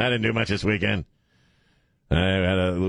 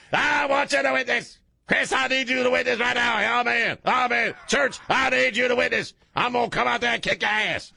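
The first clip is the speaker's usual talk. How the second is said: very high-pitched mock scream; hammed-up, high revival holler; preacher screaming in frenzy; 12 semitones higher than usual. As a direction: imitate a preacher at fever pitch. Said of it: "where he's, like, screaming".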